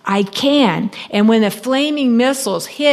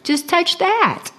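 much higher, singsong salesgirl; sweet clerk voice; 8 semitones higher than usual. Retold or imitated. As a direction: coo it like a chirpy shop girl. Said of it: "she says".

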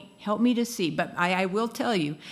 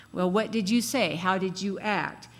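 ordinary delivery

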